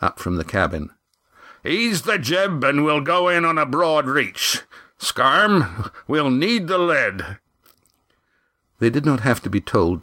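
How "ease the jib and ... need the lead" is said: high-pitched skipper's order; Roaring Jack bellowing orders. A narrator's reading. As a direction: call the order across the deck. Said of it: "A bellowed order from Roaring Jack".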